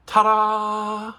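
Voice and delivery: bright showy reveal; triumphant ta-da. Real, not acted. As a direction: present it like a magic trick. Finds dropped piece, presents it.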